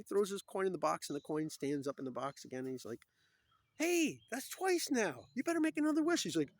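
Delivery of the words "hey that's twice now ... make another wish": high, loud, stretched-out call; hammed-up amazed shout; excited newsboy impression. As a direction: holler it like a comic street vendor. Acting newsboy after coin trick.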